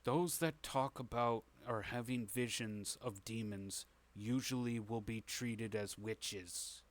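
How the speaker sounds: hushed, halting conspirator's warning; Itamir, grave and secretive. Acted. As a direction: lean in and warn them softly, with weighty pauses. Game master voices Itamir again.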